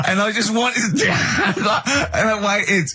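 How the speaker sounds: can hardly get the words out for laughing. Real, not acted. Laughing at his own story.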